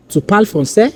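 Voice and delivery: "français" rising at the end; question intonation. Shown as a demonstration. Teacher giving an example of casual spoken French, question intonation. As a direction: ask "Tu parles français?" by lifting the last word. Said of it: "intonating that statement as a question".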